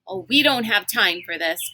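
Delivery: ordinary delivery, nothing marked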